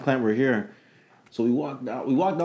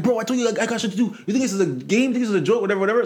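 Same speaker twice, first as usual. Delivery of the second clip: high mock annoyance; irritated client; 8 semitones higher, faster than usual. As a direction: scoff at him from up high. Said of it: "Yo, like".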